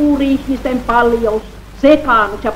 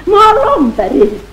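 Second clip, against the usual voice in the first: 1 high loud call; 10 semitones higher, 5 dB louder than usual. Acted. Voice calling out its name.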